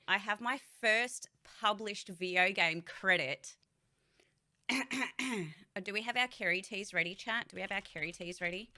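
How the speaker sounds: ordinary delivery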